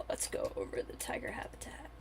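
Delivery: soft breathy aside; hushed gamer whisper. Real, not acted. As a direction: drop to a whisper for a moment. Aside while playing zoo game.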